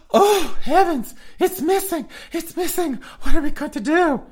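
falsetto, loud, hammed-up panic. Host voicing hikers finding the sculpture missing, loud falsetto camp panic. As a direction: shriek it in high camp dismay.

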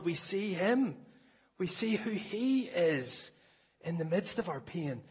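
slow exalted preacher's line; preaching climax. Acted. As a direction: lift it up in awe.

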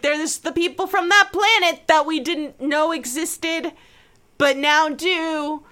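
a hint of an exasperated explainer; put-on voice. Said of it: "it's like".

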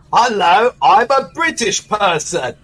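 high, slow put-on British voice; playing a posh Brit, slowly; mock British accent. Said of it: "I like his accent".

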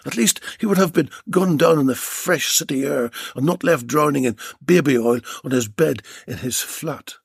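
ordinary delivery, nothing marked

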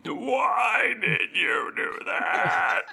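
high, slow, hammed-up crying shout; mock wail. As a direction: wail it in fake anguish. Betrayed by ally's attack.

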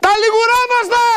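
high, insistent hawker's cry; shouted sales pitch. Real, not acted. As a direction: yell it at the viewers like a telesales hawker. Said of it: "μη φωνάζετε".

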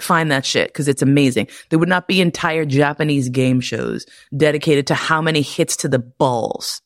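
normal talking voice

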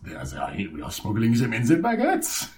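comic French smuggler; French accent. Acted. French mobster revealing the smuggling plan, mock French accent. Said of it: "it's the French mafia".

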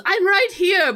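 high-pitched mock call back; Romeo. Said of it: "he goes".

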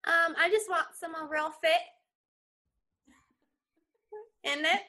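British slang in her own voice; barely attempted British accent, just the slang; London lingo, no accent. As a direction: auditioner drops London slang without the accent. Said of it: "not even trying to do the accent. She's just using the lingo".

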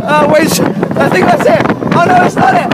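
loud, high shouting over roller coaster noise; shouting over the ride. Real, not acted. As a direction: yell every word over the wind. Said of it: "You can hear me screaming for my life".

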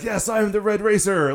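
high, mildly shouted hero roll call; retold hero yell. Red Racer yells his roll call while running in, mock yelling. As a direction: imitate a hero announcing himself. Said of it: "yelling his".

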